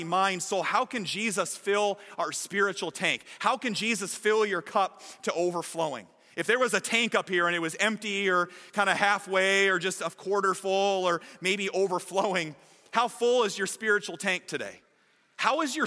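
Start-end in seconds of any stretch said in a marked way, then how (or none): none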